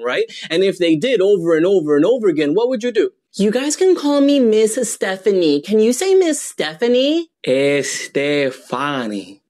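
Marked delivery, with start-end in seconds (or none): 3.3-7.3 high-pitched teacher addressing a class; teacher introducing herself. Acted. Acts teacher introducing own name to class. 7.5-9.4 Estefani drawn out slowly as es-teh-FAH-nee; deliberate mispronunciation. Acted. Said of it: "someone to mispronounce your name".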